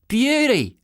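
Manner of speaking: put-on high, loud boyish cheer; admiring kid's shout; excited yell. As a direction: yell it in high-pitched delight. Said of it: "yelled Sean".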